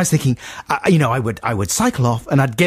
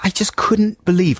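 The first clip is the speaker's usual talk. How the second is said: normal talking voice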